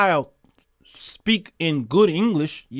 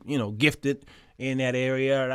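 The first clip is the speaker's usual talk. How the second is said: usual speaking voice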